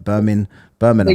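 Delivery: BIRMINGHAM as "Bur-min-um", no G; dropped G. Shown as a demonstration. Host shows how he says it, BIRMINGHAM without a G. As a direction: leave the G out of BIRMINGHAM. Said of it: "didn't even put a G in it".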